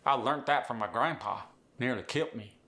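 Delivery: Appalachian with T endings; Appalachian accent. Acted. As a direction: hit "learnt" and "kilt" like an Appalachian. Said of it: "sneak a T in".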